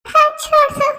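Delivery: high, loud scream from far off; distant scream. Acted. Jimin's scream reaches Suga from far away, shrieking. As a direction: cry out for help from afar.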